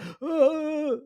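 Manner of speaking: a hammed-up high gasp of shock; mock shocked gasp. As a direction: gasp like the kid's been insulted.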